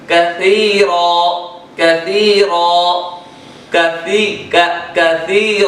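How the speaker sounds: careful tajweed demo of کثیر; Quranic example. Shown as a demonstration. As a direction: say کثیر with the tongue against the upper teeth. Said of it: "اسی طرح".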